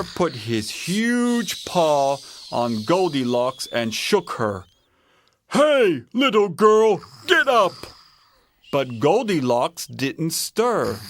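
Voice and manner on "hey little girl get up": loud, raised bear voice; Papa Bear waking someone. A narrator's reading. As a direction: call out to rouse a sleeper. Papa Bear shakes sleeping Goldilocks.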